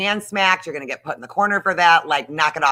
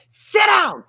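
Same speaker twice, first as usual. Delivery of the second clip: much higher and louder mock yell; retold screaming; 8 dB louder than usual. Mom screaming at her husband Neil, mock yell. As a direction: yell it down at him like a furious mom. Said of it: "I will literally be screaming at Neil".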